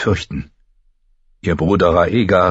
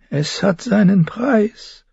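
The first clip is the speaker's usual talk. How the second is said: very high, slow and ominous; slow, high-pitched witch's caution; warning; slower than usual. A narrator's reading. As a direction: warn her, drawing out each word. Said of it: "warnte das Götterweib".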